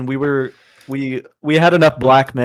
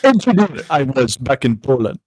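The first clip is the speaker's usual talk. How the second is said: a light put-on Eastern European accent; half-hearted Eastern European dad accent; mock Polish father accent; 4 semitones higher than usual. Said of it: "more of, like, a Kazakhstani accent".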